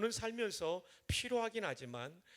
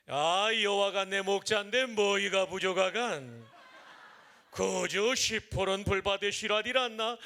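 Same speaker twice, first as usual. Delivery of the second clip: Pyongan dialect; 6 dB louder than usual. Shown as a demonstration. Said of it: "평안도 버전입니다". (평안도 사투리로 크고 느리게 낭독)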